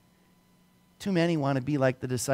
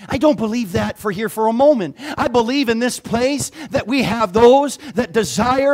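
louder, higher over the music; rally the room, building to the altar call; full-force preaching; 11 semitones higher, 11 dB louder than usual. Acted. Pastor calls the church to serve Jesus, preaching hard.

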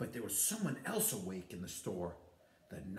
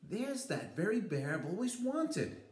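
put-on high, bright little girl voice; excited girl pointing at a toy; 10 semitones higher than usual. A narrator's reading. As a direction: voice her as an eager small child. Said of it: "she said".

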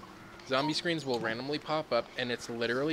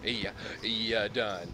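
slow; much slower than usual. Acted. Slow final verdict.

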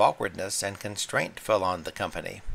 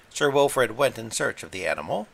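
plain reading voice

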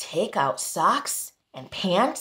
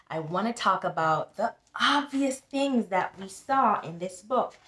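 usual speaking voice

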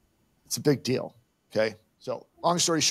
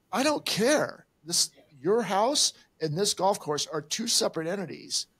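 higher, sharp dismissive outburst; heated retort; 7 semitones higher than usual. Retold or imitated. Golfer telling the HOA lady he doesn't care, heated and higher. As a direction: shut her excuse down hard. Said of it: "And I go".

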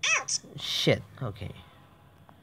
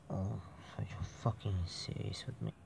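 ordinary talk, nothing marked

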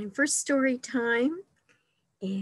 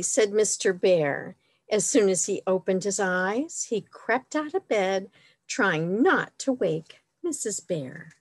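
animated picture-book narration, a bit louder than chat; storytime reading; 4 dB louder than usual. A narrator's reading. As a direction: read it out expressively for little listeners. Reads picture book to children.

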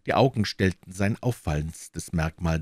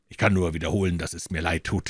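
ordinary delivery

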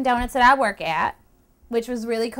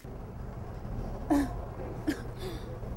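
breathless mock sob; a soft fake sob; hammed-up sob; 3 semitones higher, 12 dB quieter than usual. Fake cry after rant.

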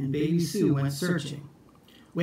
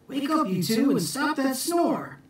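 high, raised wake-up call; 9 semitones higher than usual. Acted. Jack waking the twins, high call. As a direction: call up to them, much higher.

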